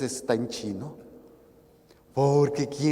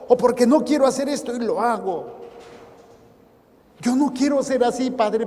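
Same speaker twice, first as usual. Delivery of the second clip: fast, higher-pitched mock complaint; exasperated parishioner confessing; 6 semitones higher, 3 dB louder, faster than usual. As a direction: pour it out to the priest, hammed-up and frustrated. Acts out parishioner confessing confusion.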